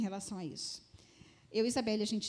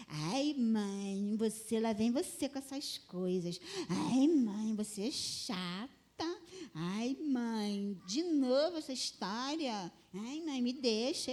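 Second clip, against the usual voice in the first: lightly voiced exasperated teenage daughter; a hint of sulky daughter; mock teenage whine; slower than usual. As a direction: imitate a fed-up teen, stretching the words out. Acted teenage daughter brushing off mother.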